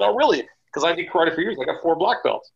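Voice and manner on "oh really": high, loud mock-surprised question; mock surprise. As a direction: feign being impressed, pitch up. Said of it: "I said".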